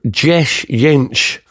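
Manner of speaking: dziesięć said slowly in careful Polish; dziesięć pronounced the Polish way, drawn out; Polish number. Shown as a demonstration. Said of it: "which is Polish for".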